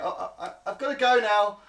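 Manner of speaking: high, rising to loud hasty kobold exit; squeaky panic to get away; flustered kobold. Acted. Kobold hurrying off from the tower window: high flustered voice. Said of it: "And you hear".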